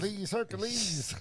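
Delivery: mock hero voice. Jokingly calls him Hercules.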